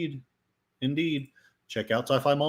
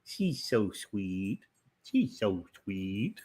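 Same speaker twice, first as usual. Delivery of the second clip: viewers cooing; 3 semitones lower, slower than usual. Retold or imitated. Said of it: "and go".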